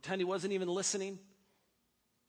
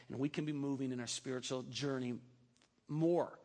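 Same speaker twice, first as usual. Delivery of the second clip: prayer; 7 semitones lower than usual. Acted. Closing prayer.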